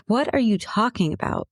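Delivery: mildly acted disbelief, voice lifting; retold bafflement. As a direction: imitate a friend caught off guard. Friend's retold baffled reply to apology.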